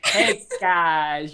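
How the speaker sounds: ordinary delivery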